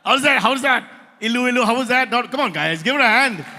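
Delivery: loud, high-pitched hype; rousing applause call. Real, not acted. Host asking the crowd to applaud the singer, rousing shout. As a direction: whip the crowd up.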